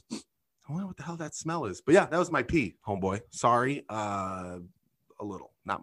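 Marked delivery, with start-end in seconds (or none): 0.1-1.8 much softer, muttered to himself; puzzled aside under the breath; quiet mock wondering; imagined musing. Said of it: "just probably going like".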